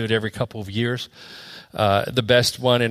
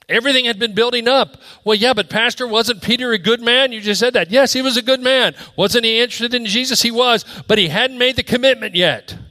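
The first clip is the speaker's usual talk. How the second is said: rising, insistent sermon voice; preaching at full force; 10 semitones higher, 4 dB louder than usual. Acted. Pastor to congregation, fervent full-force preaching. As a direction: press the point harder and higher.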